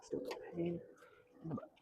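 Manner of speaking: breathy whisper, under his breath; whispered aside. Real, not acted. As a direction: mutter it to yourself, barely voiced.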